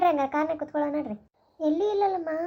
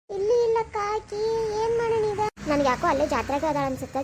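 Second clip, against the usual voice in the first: normal talking voice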